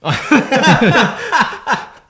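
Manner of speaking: big high laugh breaking out; bursting into laughter at his own joke; loud burst of laughter. Real, not acted. Laughing hard at the widescreen joke.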